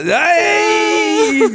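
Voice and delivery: high outraged shout. Retold or imitated. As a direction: yell like an offended owner. Italian owner bursts out.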